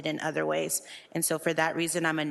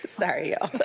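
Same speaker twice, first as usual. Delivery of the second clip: laughing; 6 semitones higher than usual. Real, not acted. Apologises laughing after sigh.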